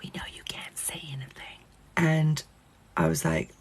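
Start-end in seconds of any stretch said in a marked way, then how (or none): 0.0-1.6 hushed conspiratorial whisper; mock knowing whisper. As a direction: lean in, secretive and complicit. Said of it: "they'd be like".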